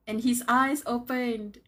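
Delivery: slow, loud dramatic reveal; dramatic build. Real, not acted. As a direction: read it like the big moment. Dramatic reading moment.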